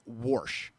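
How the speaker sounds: Warsh with an r before the sh; grandfather's warsh. Retold or imitated. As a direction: say Warsh, adding an r: warsh for wash. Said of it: "our grandfather would say".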